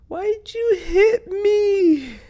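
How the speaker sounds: imitating his girlfriend's crying, very high and small; a whimpering, tearful squeak as if struck; hurt, heartbroken. Girlfriend hit in her sleep, asking him through tears. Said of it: "so hurt and heartbroken".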